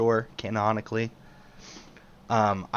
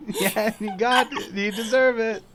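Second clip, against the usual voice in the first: laughing; 4 semitones higher than usual. Real, not acted. Laughing while tickling.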